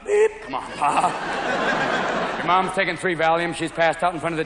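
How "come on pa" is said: usual speaking voice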